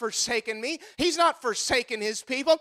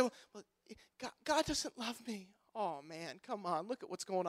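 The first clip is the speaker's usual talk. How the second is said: much softer, sulky mock mumble; mopey mock complaint; 4 semitones lower, 15 dB quieter than usual. Preacher mimicking sulky Christians, soft mock mope. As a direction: shrink into a sorry little gripe. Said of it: "we've come to a place in Christianity where we say".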